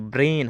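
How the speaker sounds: ब्रेन with "ay" as in day; teacher's correct ब्रेन, rhyming with rain; correct vowel. Shown as a demonstration. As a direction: say ब्रेन as "brayn". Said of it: "इसको ब्रेन पढ़ना है".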